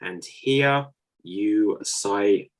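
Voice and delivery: slow, stretched game-show cue; slow dramatic announcer cue; drawn-out host cue. Acted. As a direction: drag it out to build suspense. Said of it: "host cue, drawn out".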